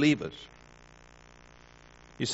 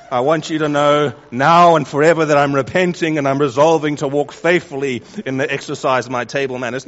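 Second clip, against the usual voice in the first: hammed-up solemn apology, louder; mock grand confession; 6 dB louder than usual. Preacher mocking a churchgoer's overblown apology over a drop of sauce.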